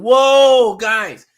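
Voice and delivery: loud, high burst of real surprise; surprised whoa. Real, not acted. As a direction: blurt it out, caught off guard. Reacting to a comment.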